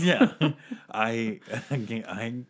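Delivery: laughing through the words; can't stop laughing while talking. Real, not acted. Laughing mid improv.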